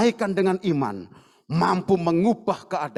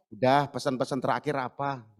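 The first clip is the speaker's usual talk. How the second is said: mock blunt visitor; 8 semitones lower, 5 dB quieter than usual. Mock visitor asking last wishes.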